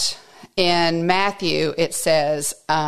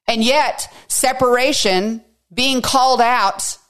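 emphatic; 6 semitones higher, 3 dB louder than usual. Real, not acted. Preacher rising in emphasis.

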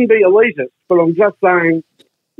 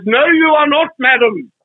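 much higher, emphatic mock-grand scolding; radio host's retort; 8 semitones higher, slower than usual. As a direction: declaim it to the caller, drawing out the final words. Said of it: "And Norman Banks said".

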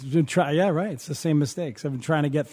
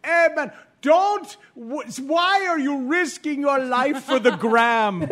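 put-on slow, high Jamaican accent; laughing through a Jamaican accent impression; 8 semitones higher, slower than usual. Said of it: "it was in a Jamaican accent".

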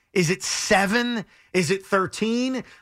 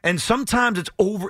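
normal talking voice

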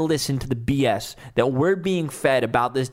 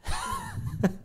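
sheepish laugh; 8 dB quieter than usual. Real, not acted. Laughs at own outburst.

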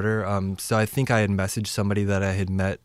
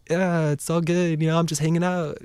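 put-on easygoing tone, pitched up; playing it cool; 7 semitones higher than usual. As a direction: stretch it out lightly, feigning nonchalance. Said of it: "trying to keep it cool".

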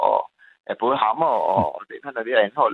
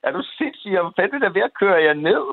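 indignant yell; 6 semitones higher, faster than usual. Retold or imitated. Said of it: "Så siger jeg".

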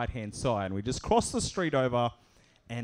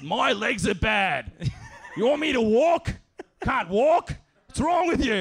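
high, loud outraged complaint; indignant rant; 9 semitones higher, 5 dB louder than usual. Retold or imitated. Homeless man indignantly scolding him about his bad legs. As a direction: act offended he'd ask.

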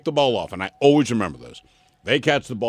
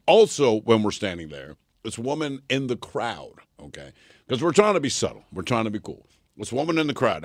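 ordinary talk, nothing marked

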